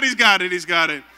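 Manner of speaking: loud call to the congregation; shouted proclamation. Acted. Pastor getting the church to shout along, loud proclamation. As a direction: shout it out for everyone to echo. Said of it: "Somebody shout".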